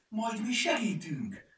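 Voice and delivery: high, loud, drawn-out eager offer; beaming eagerness. A narrator's reading. Kajtánka offers help with the broken barrier, eager and beaming. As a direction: pipe up brightly, beaming with eagerness. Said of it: "ragyogott fel Kajtánka".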